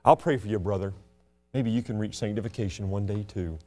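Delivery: mock a proud sanctified churchgoer. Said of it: "they have this mentality".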